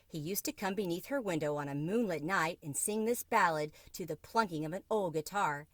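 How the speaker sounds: ordinary delivery, nothing marked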